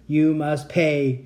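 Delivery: very slow mechanical monotone; drawn-out, level robot chant; stuck robot repetition. A narrator's reading. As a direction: repeat it like a broken machine. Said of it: "he kept repeating as if the words were".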